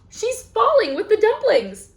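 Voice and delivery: gasp, then a high, loud alarmed cry. Acted. Reading to kids as Liddy slips, gasping alarmed cry. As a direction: sound the alarm as she falls.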